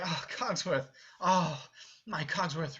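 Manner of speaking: fond greeting. Shown as a demonstration. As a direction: greet him like a dear old friend.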